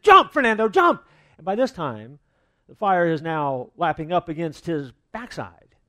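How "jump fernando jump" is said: much higher and louder hammed-up shout; shouting jump. Firefighters shouting up at Fernando on the roof, loud and high. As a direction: bellow it up at the roof. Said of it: "And they said".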